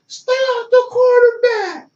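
high, slow, stretched-out yell from across the field; mock coach yell. Coach yelling at a player from the sideline, high drawn-out yell. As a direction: draw it out high like a coach hollering. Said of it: "can't yell".

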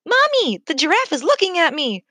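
put-on high, bright, squealing little kid; a thrilled toddler calling to mom; excited child's cry. A narrator's reading. Small child in mother's arms shouting to her mommy, excited. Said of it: "cried a small human".

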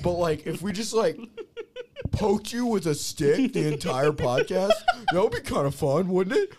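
high, mocking impression of an eager idea man; Max. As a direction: pitch it up, gleefully pitching silly ideas. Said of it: "Max ideas for this podcast".

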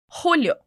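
Julio with a throaty Spanish J, like the Portuguese R in rádio. Shown as a demonstration. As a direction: Julio said hoo-lio, the J a raspy H. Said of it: "o som do J no espanhol".

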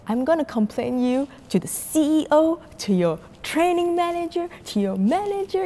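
high-pitched imitation of an angry complainer; yelling customer. Customer threatening flight attendant with complaints, mock angry. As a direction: rattle off threats in a mock indignant voice. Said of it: "he was, like, yelling".

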